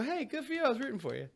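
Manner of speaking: high, soft cheering; a viewer cheering on a contestant; mock cheer. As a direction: cheer them on sweetly. Cheering contestant who scores.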